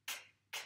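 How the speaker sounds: "k" as a bare voiceless click; isolated k sound. Shown as a demonstration. Teacher isolating the sound for a pupil, bare "k". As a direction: say "k" alone, no vowel after it. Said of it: "le son k".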